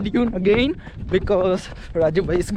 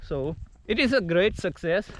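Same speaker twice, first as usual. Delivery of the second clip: ordinary talk, nothing marked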